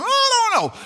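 high squeaky no-no-no; mock denial. Listener hoping the answer isn't yes in mock denial. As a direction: play a listener squirming in denial.

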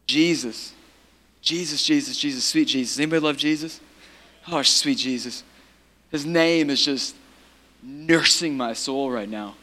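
tender worship. Real, not acted. Preacher nursing his soul with Jesus' name, tender worship.

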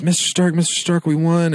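high, urgent cry for his mentor; desperate Peter. Retold or imitated. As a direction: plead with him like a scared kid. Peter Parker reaches dying Tony.

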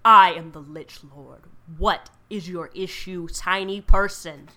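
loud, imperious lich lord; ancient rasping undead king. Acted. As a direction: loom over them as a haughty lich. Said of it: "zooms down in front of you and is like".

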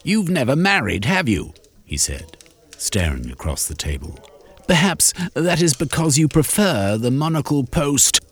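high, cutting jab; Shermy's anger. Acted. Angry Shermy jabs at unmarried Sir Hosley, high cutting sneer. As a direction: strike back with a cold sneer.

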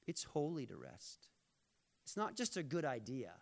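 usual speaking voice

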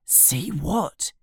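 soft, high hushed question; whisper. A narrator's reading. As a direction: ask it in a mild stage whisper. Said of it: "asks Ron in a whisper".

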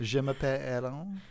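imitating French accent. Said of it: "with the accent of your Aaron".

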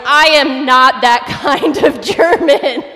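loud, slow, drawn-out retort with a laugh; half-laughing, emphatic mock outburst; exasperated protest. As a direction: belt out the protest, stretching each word. Acted panicked retort to groupmate's request.

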